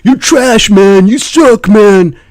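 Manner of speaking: high, loud nasal-free whiny taunt; whiny toxic gamer. Retold or imitated. Mimicking the toxic player before the Moab, high whine. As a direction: sneer it back at the troll. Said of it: "This is what they're gonna hear".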